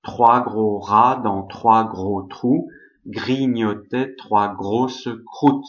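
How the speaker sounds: slow French tongue twister, low and deliberate; measured French with throaty Rs; tongue-twister demo. Shown as a demonstration. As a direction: repeat the French exercise slowly for learners. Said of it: "it's kind of difficult".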